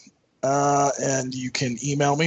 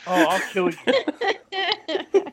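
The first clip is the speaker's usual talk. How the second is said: slow, high, laughing threat; laughing mock threat; 11 semitones higher, much slower than usual. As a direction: threaten him through laughter.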